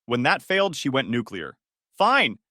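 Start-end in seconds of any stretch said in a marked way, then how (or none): 2.0-2.4 angry outburst. Acted. She went nuclear.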